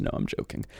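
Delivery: whispered aside; hushed whispered aside. Real, not acted. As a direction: whisper it to yourself. Whispered aside then said aloud.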